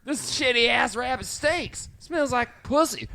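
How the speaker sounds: high, loud, slowed bratty complaint; mock kid ranting. Co-host joining in as the rabbit-hating kids, high loud imitation. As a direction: whine loudly like a foul-mouthed kid.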